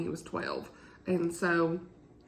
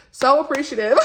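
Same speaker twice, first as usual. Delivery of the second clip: laughing, louder and higher; laughing emphasis; 6 semitones higher, 15 dB louder than usual. Real, not acted. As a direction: laugh through the repeat.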